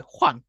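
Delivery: "Huan" short and falling, chopped off at the end; quick fourth tone. Shown as a demonstration. Teacher demonstrating the abrupt fourth tone, "Huan" quick and falling. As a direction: drop quickly through "Huan" and cut it off. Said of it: "you say this one quite quick".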